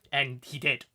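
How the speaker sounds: ordinary talk, nothing marked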